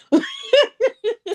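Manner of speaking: high, bright burst of laughing; amused high giggle. Real, not acted. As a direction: laugh out high at your own boast. Laughs at own boast.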